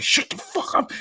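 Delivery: high, strained mock yell; retold outburst. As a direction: imitate a fed-up parent's outburst. Retold outburst at his son.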